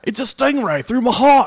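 high, slow, hammed-up Aussie presenter; mock Australian accent. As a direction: draw it out as an over-the-top Australian.

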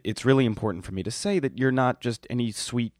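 ordinary talk, nothing marked

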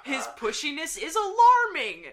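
loud, high exclamations from angry notes; all caps. Acted. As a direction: shout each phrase in mock outrage. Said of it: "I'm seeing all caps".

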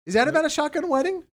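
high-pitched, quick teasing question; high fast question. Real, not acted.

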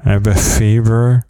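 quick "fever" through missing teeth; fast. Shown as a demonstration. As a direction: rattle off "I have a fever". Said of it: "Let's say some words I can't say".

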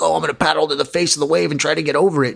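lightly voiced careless surfer thinking aloud; imitating a pushy surfer. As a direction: rattle it off like the guy cutting in. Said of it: "you're like".

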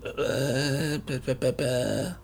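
soft, feeble "bah" notes; imitated weak synth music. Mocking the film's weak soundtrack, limp hummed-out notes. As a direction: quietly mimic a lacklustre keyboard. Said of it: "Now you just go".

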